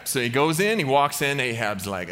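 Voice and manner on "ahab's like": ordinary talk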